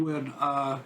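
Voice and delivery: ordinary talk, nothing marked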